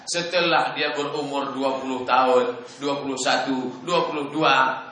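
usual speaking voice

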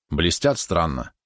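ordinary delivery, nothing marked

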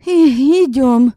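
high, tight strained order. Acted. Yaska urging the women away, high and strained. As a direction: force it out through a tight throat. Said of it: "велела Яська сдавленным голосом".

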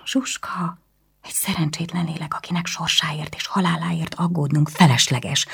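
furious hissed whisper. A narrator's reading. Anna hisses back at her brother, whispering. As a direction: spit it out under the breath.

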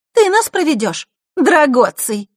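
put-on very high, loud smiling girl; Маришка. A narrator's reading. Girl steps forward unexpectedly, high smiling voice. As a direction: pipe up brightly with a smile. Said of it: "произнесла она, улыбаясь".

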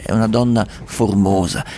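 ordinary delivery

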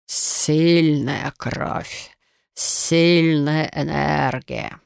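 slow serpent hiss; basilisk. Acted. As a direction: hiss it out slowly like a snake. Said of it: "прошипел змей".

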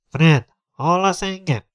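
slow, high-pitched hail to a friend; calling out. Acted. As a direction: stretch it out so it carries. Calling friend over loudspeaker. (elnyújtva odakiáltva)

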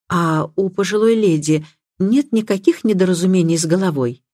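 ordinary delivery, nothing marked

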